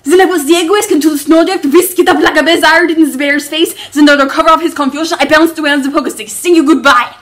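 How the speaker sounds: loud, fast, breathless rush through the tale; captain rushing the end of his made-up bear story, loud and fast. Acted. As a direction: gabble the ending to get it over with. Martin races through story climax.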